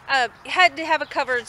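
ordinary talk

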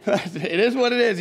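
ordinary talk, nothing marked

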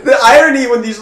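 high shrieking laughter; cackle, then talk through laughs; loud high laugh. Real, not acted. Big laugh at joke.

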